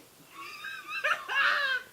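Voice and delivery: big high laugh breaking out; loud burst of laughter. Real, not acted. Laughing hard while telling friends the drunk-crying story. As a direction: crack up loudly at the memory.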